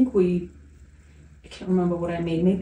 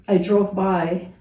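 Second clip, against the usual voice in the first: ordinary delivery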